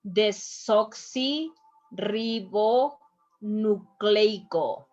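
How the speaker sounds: "Desoxirribonucleico" very slowly, in separated chunks; slow teacher's breakdown of "Desoxirribonucleico", with pauses; syllable by syllable. Shown as a demonstration. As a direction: sound out "Desoxirribonucleico" as de-soxi, rribo, nu-cleico. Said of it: "we have to pronounce all the letters".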